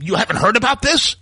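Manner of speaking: high, loud, pouncing disbelief; spring it on him like a gotcha; imitating an interviewer's shocked gotcha; mock incredulous question. Stahl springs question on Trump.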